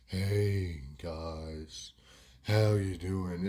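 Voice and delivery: slow, low, drawn-out greeting; Wado's slowed speech. Retold or imitated. Slowed mate coming round to hang out, slow low greeting. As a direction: stretch every word, pausing between them. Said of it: "He's talking a lot slower than what he used to".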